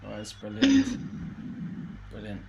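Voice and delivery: ordinary talk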